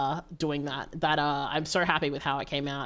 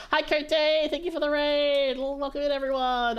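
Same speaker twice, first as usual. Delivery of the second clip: excited greeting; 8 semitones higher than usual. Real, not acted. High excited raid greeting.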